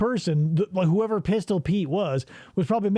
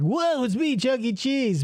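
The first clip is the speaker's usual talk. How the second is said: mascot voice; 6 semitones higher than usual. Retold or imitated.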